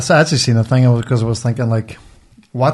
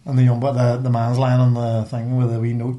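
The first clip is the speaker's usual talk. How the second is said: ordinary delivery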